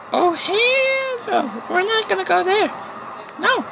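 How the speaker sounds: slow, very high squealing refusal; scared squeal. Real, not acted. Gamer refusing to enter the scary place, high slow squeal. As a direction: squeak it out in terror, drawn out.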